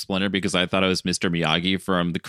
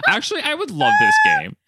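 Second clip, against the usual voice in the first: laughing; 5 dB louder than usual. Real, not acted. Excited laughing outburst.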